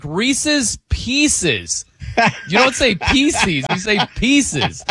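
Reese's Pieces said slowly and carefully, correcting; slow, deliberate correction of "pieces"; lightly imitating a friend setting him straight; correcting pronunciation. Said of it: "He's like".

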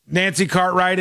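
usual speaking voice

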